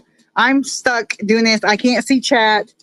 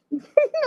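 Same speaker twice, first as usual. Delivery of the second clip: high squeaky laugh; 5 dB quieter than usual. Real, not acted. After the bite.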